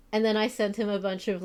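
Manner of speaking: ordinary talk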